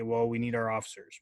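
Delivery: ordinary talk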